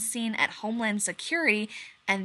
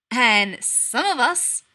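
announced; 3 semitones higher, 8 dB louder, much slower than usual. A narrator's reading. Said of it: "Rodney McKay announced".

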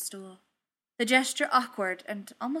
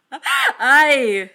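huge, high, jubilant roar; 5 semitones higher, 16 dB louder than usual. A narrator's reading. Thor accepting the bot's challenge, roaring. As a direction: bellow it through the laughter. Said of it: "he roared".

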